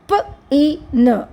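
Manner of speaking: "ప, ఈ, న" as three separate sounds; sounded out. Shown as a demonstration. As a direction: break "ప, ఈ, న" apart with short weak consonants. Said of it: "week సౌండ్స్కి ఒక vowel సౌండ్".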